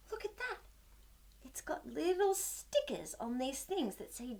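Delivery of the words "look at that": quick whispered wonder; hushed amazement. Acted. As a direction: gasp then whisper it fast. Gasping at the picture.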